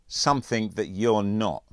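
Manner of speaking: not hit hard as the final word; stress on not. Acted. Andrews shutting Mitchell down, heavy stress on not. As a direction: punch the word not to end the matter. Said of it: "The stress on the last word".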